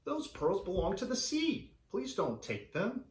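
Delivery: high, pleading mermaid voice; crying mermaids. Acted. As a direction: beg him to leave the pearls, high and sweet. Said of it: "The mermaids cried".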